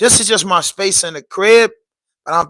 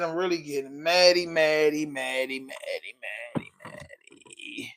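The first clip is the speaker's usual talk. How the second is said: maddy repeated, fading down to a whisper; mock tantrum fading away; playful baby-talk pout; 2 semitones lower, 9 dB quieter, slower than usual. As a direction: pout it like a kid, trailing off. Playful pouting over broken gear.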